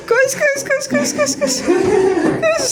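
high, excited calls to run; excited cheering. Real, not acted. Cheering child running to base.

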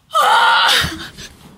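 loud, high shrieking gasp; shocked gasp. Real, not acted. Streamer seeing the subscriber goal reached, shocked gasp.

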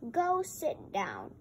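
ordinary delivery, nothing marked

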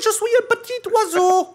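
very high squeaky bird voice in French; high bird squeak after the Québécois take; squeaky little bird. Pretend. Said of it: "a really beautiful little bird".

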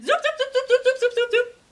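string of very high, loud zoops; rapid zoop sounds. A narrator's reading. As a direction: rattle off squeaky machine pops.

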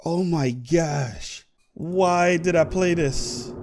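low, soft, slow dejected; deflated and defeated. Real, not acted. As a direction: sigh out your defeat. Just got killed in game.

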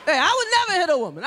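ordinary delivery

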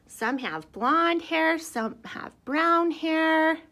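put-on reading to children. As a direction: read it out big for the little ones. Reading book to kids.

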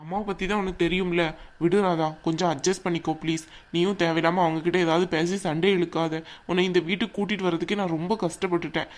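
normal talking voice

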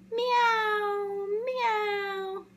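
very high sung-out meow; meowing kitten. Acted. Kittens calling out, very high meows. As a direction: mew like a tiny kitten. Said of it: "They go".

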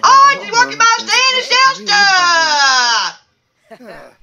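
high-pitched put-on voice, held nearly level; odd bachelor character. Bachelor one answering the host's question, high put-on voice. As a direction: chant it high, stretching the final word.

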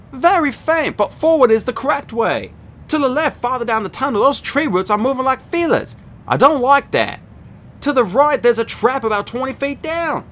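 a hint of a sure, uneasy teen girl. A narrator's reading. Rachel tells Annabeth and Percy what she sees, earnestly.

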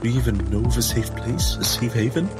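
ordinary talk, nothing marked

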